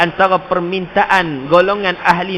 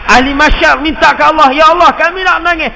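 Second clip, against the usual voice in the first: fiery preaching; 12 semitones higher, 10 dB louder, faster than usual. Acted. Preaching at full force.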